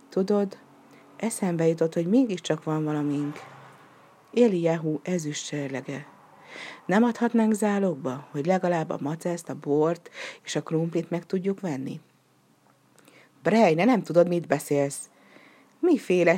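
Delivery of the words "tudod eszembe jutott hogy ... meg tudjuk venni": usual speaking voice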